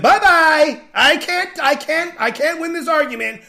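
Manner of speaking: high, loud mock whine; sing-song exit, mocking her whine; mocking woman quitting. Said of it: "She said".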